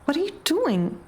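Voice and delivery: high, bewildered little sister; perplexed. A narrator's reading. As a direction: ask in confused disbelief.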